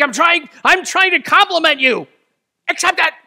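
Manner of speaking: very high, loud, exasperated demand; exasperated insistence. Retold or imitated. Frustrated friend demanding the compliment be accepted, high and loud. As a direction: demand they take the compliment, near shouting.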